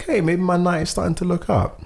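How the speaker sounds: retold hopeful thought; hopeful inner voice. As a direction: let hope creep in. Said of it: "I was like".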